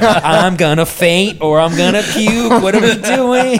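high, loud mock panic; rising, hammed-up frantic plea; panicked yelling. Injured worker urging boss to go, panicked yelling.

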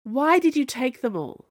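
high, loud demand. Acted. Camilla confronting the Snow Queen, demanding. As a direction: demand an answer. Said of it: "Camilla demanded".

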